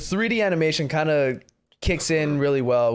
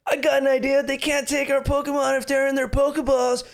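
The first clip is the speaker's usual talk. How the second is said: much higher, eager hero voice; mock Ash; 11 semitones higher than usual. Ash announcing his plan to the trainers, high eager voice. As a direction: pitch it up like a proud kid hero. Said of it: "And Ash is like".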